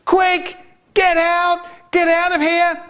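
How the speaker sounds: very high, frantic cry; screamed warning. A narrator's reading. Haribo bursts in bloodied, high screamed warning. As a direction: pitch it right up in panic. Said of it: "and he screamed".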